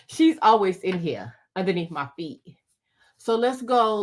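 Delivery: ordinary delivery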